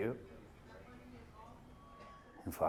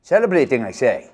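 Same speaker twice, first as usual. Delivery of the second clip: loud, much higher burst; indignant shout; 5 semitones higher, 15 dB louder than usual. Acted. Victor shouting to the waiting room, indignant. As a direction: bellow the word out.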